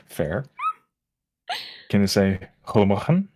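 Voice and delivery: ordinary talk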